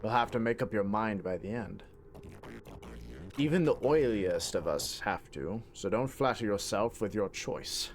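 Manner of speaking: put-on light British accent for a sly NPC; understated British villain reading. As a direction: read it in a lightly British, knowing tone. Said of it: "kinda like a British accent".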